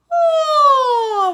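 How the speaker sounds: loud high yawning oh. Shown as a demonstration. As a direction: open up like a yawn. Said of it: "it's almost like a yawn".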